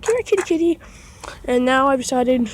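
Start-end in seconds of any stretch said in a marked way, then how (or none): 0.0-0.8 higher coaxing call, lightly voiced; calling a kitty. Retelling coaxing the stray cat over, higher kitty call. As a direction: coax the cat over sweetly. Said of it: "I'm like".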